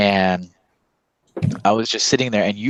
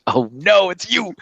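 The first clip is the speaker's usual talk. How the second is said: mock dread; 9 semitones higher, slower than usual. Dread at seeing fan again.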